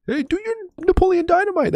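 very high squeaky mock voice; mock chat request. Streamer voicing his chat asking for Napoleon Dynamite, very high and squeaky. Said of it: "That's my impression".

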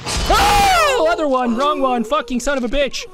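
high shriek dropping into frantic cursing; real jump-scare outburst; startled yelp. Real, not acted. Startled mid-game, cursing the wrong move.

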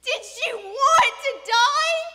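slow, high, wailed question; sobbing. A narrator's reading. Father asking if daughter wished death, sobbing. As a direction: shout it through sobs, drawn out. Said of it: "he was sobbing".

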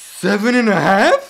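disbelief. Real, not acted. Hearing her health rating.

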